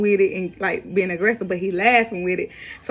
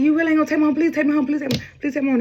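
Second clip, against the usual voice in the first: high-pitched, urgent retold begging; imitating a panicked plea; frantic pleading; 7 semitones higher, much faster than usual. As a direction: replay desperate begging, pleas tumbling out.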